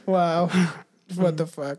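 ordinary talk

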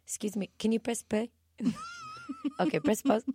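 soft, accented singer impression. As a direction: address the audience softly in a mock singer's accent. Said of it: "she just was like".

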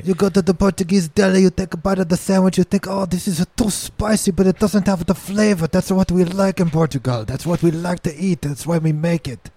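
mock proud Portuguese deli owner accent; fake Portuguese accent. Bragging about Portuguese food in a fake Portuguese accent. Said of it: "racially ambiguous fucking accent".

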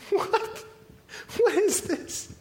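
high, airy bewilderment; freaked out. Acted. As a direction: gasp it out in disbelief. Acting freaked out at the demon.

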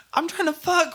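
high-pitched pretend koala; squeaky-high animal character. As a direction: put on a high little koala voice. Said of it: "could you imagine a koala just like".

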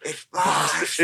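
slow, drawn-out film quote; Little Nicky impression. Friends settling which band he names, slow impression. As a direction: stretch the city name in character. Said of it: "he might go".

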